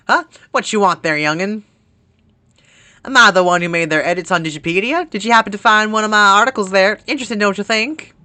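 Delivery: put-on loud, fast country accent; hammed-up country folksy voice. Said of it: "every time I do a country accent".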